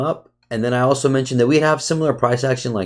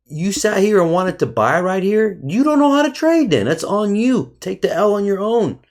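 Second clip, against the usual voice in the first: raised, higher pitched scolding; heated rant; 8 semitones higher than usual. Real, not acted. Scolding viewers who blamed him, heated and raised. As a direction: let them have it, voice climbing.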